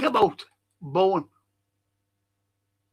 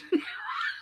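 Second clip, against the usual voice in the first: burst of laughter; 7 dB quieter than usual. Real, not acted. Reacting to chat joke.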